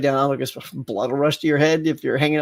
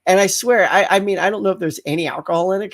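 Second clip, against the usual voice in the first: ordinary talk, nothing marked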